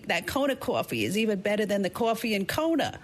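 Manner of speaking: a mom from Brooklyn, high and emphatic; Brooklyn accent. Retold or imitated. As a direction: son mimics his Brooklyn mother's accent. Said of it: "in her Brooklyn accent".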